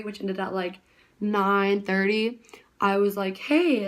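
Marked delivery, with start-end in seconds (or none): 3.4-3.9 a high, loud hey across the studio; calling out. Retold or imitated. Dancer calling the studio teacher, loud high hey. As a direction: grab the teacher's attention.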